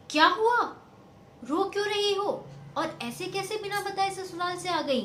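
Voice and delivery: loud, high, startled mother's cry; alarmed mother. Acted. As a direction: rush out the worry in alarm. Mother sees daughter crying. (बेटी को रोता देख घबराई हुई ऊँची आवाज़)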